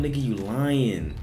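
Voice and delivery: ordinary talk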